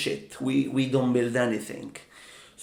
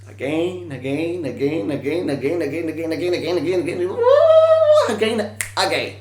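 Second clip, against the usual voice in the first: frenzied run-on repeating, exploding at the end; manic building repetition; 2 semitones higher, 5 dB louder than usual. Acted. Endless repetition building to a cry.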